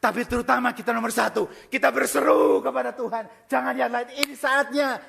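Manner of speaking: loud, rising sermon shout; fiery preaching. Acted. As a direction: preach it at full force to the congregation. Preaching at full force.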